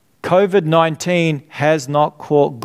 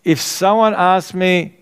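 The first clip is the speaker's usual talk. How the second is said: usual speaking voice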